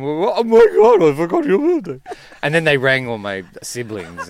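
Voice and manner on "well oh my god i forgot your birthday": dad's surprise. Retold or imitated. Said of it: "my dad comes home".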